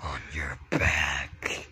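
slow eerie whisper; creepy whisper. Acted. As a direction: draw it out in a hush. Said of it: "Doing that voice".

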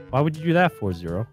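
ordinary talk, nothing marked

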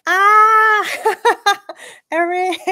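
high, loud burst of surprised laughter; delighted laugh. Real, not acted. Streamer spotting a new gift in chat, laughing high and loud. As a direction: laugh out loud, caught off guard.

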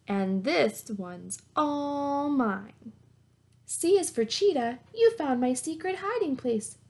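bright, lively picture-book read-aloud; reading to children. Acted. Teacher reading a zoo alphabet book to her class, lively read-aloud. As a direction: read it like story time for little kids.

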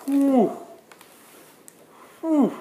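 high delighted ooh; excited ooh. Real, not acted. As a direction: gasp with delight. Excited gasp at unboxing.

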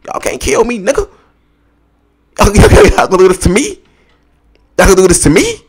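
high, loud actor impression; Denzel Washington. Vlogger quoting an actor at those who can't kill him, high and incredulous. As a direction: cry out in outraged disbelief like a movie star. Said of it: "Denzel Washington".

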